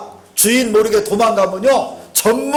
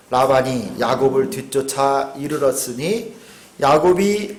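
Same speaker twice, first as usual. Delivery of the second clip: measured reading of scripture; 4 semitones lower than usual. Acted. Preacher reads Laban's words to the congregation, solemn. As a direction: read the verse out solemnly.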